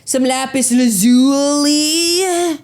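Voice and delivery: mock show-off settling down on lazuli; mock dude boast, falling. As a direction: state some lapis lazuli like a proud final word. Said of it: "you were like".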